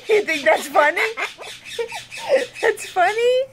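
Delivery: high, giggling words through laughter; laughing. Real, not acted. After a slip of the tongue, asking through laughter. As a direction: laugh while you talk.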